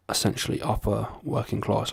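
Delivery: ordinary talk, nothing marked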